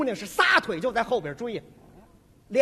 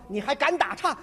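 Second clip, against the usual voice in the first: normal talking voice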